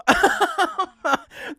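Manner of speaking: a long, loud, high laugh; big laugh. Real, not acted. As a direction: let out a big happy laugh. Laughs at own "I'm home.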